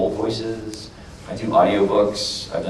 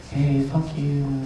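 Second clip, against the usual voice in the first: slow, higher, sweet and cute little voice; Ichiro's soft voice; 5 semitones higher, 4 dB quieter, slower than usual. Shown as a demonstration. Voice actor showing off his character's soothing voice. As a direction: say the insult as sweet and cute as you can. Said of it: "you could say anything in Ichiro's voice".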